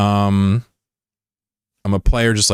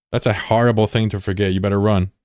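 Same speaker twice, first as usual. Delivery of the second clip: ordinary delivery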